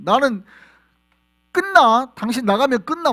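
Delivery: loud, high threat; God's angry warning to a faithless lover; furious ultimatum. Acted. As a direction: shout the ultimatum. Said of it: "뭐 이런 거죠".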